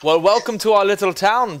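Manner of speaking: high, loud greeting in character; welcoming guard. Acted. Town guard greeting a visiting paladin, heartily welcoming. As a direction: hail the newcomer with a flourish.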